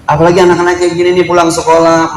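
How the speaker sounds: normal talking voice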